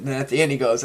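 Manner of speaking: ordinary delivery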